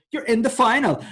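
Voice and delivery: high, loud gushing verdict; mock announcement. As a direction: proclaim it excitedly, imitating a judge. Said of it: "and he'd go".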